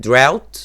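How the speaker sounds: "Drought" said loud with ow as in cow; drought pronounced clearly. Shown as a demonstration. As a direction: stress the ow sound in "Drought". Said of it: "خد بالك من ال pronunciation". (نطق "Drought" بصوت عالي وواضح)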